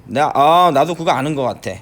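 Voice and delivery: normal talking voice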